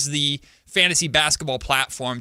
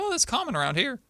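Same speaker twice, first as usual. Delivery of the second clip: imitated South Carolina accent; 10 semitones higher, 4 dB quieter, faster than usual. Aunt explaining a local word to her relative, imitated Southern accent. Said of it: "she speaks exactly like them now".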